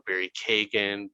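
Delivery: Keoghan said as "Kagan", KAY-gun; variant pronunciation. Shown as a demonstration. Host listing ways people say the name, variant pronunciation. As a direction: say "Kagan" with a long ay and hard g. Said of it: "so many different pronunciations of his name".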